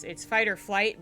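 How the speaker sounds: normal talking voice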